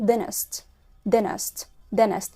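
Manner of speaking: "dentist" as "dennist"; dropped T. Shown as a demonstration. Teacher showing how Americans say "dentist". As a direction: skip the first T in "Dentist". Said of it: "مش هتتقال dentist".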